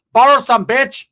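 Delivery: hammed-up aunt barking an order; tia yelling. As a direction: snap it back at him, loud. Tia snaps back in story.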